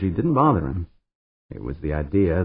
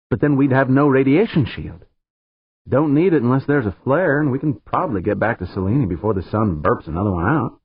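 higher, brighter-pitched woman pilot; Pancho's voice; 6 semitones higher, 5 dB louder than usual. Acted. Engineer Pancho explaining the plan to Dan, higher voice.

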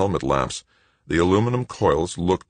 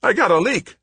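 very high, loud alarm; alarmed high-pitched yell; panicked shout; 6 dB louder than usual. A narrator's reading. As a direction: cry out in panic. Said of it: "Wojo shouted".

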